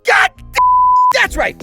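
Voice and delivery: normal talking voice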